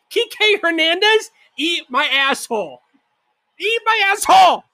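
high, loud curse at the player; furious fan cursing a player; angry yelling. Real, not acted. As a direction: yell at the TV in rage.

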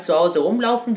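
ordinary talk

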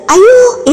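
sharp high cry of alarm; a sudden shocked yelp from behind; high startled outcry. A narrator's reading. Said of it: "എന്നൊരു വിളി".

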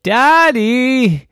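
loud high call for Daddy. Acted. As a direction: call across the house for help. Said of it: "Chloe said".

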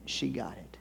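very soft, low near-whisper; hushed realization. Retold or imitated. Husband realizing his wife got it, hushed. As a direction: drop right down, letting it sink in.

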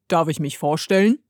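lightly voiced stranger bird, measured. A narrator's reading. Bird introduces himself to Heidi, lightly voiced creature. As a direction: introduce yourself as a mysterious little creature.

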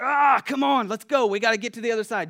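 loud, high, urgent shouting; straining rowers. Acted. Fishermen rallying each other against the wind, straining and loud. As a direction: groan it out, urging the crew on against the wind.

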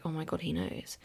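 very soft, panicked aside; hushed dread. Retold or imitated. Daughter after dad leaves the room, hushed dread. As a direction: say it under your breath, to yourself.

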